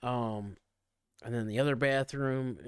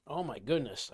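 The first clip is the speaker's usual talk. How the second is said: ordinary talk, nothing marked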